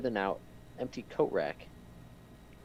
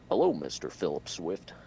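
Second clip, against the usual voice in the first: lower-pitched amateur impersonation; Barack Obama; 4 semitones lower, 4 dB louder than usual. Acted. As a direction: attempt a presidential voice, not very well. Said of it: "I can't do Obama's voice very well".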